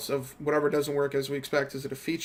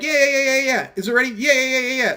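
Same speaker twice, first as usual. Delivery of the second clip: higher, louder, rapid dismissive yeahs; mocking yes-men; 7 semitones higher, 5 dB louder than usual. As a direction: parody the eager agreement. Mocking coworkers' eager yeses.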